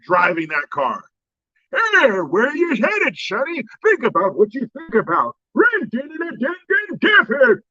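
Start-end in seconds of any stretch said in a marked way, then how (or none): 1.7-7.6 put-on high, slow old-man croak ending in a shouted din; old George Burns impression. Comic mocking a doddering old driver, in a high old-man voice. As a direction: put on a creaky old crooner behind the wheel. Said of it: "mixed with George Burns".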